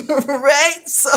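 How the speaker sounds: laughing, amused. Real, not acted. Narrator laughing at his younger self, amused. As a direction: laugh through the word.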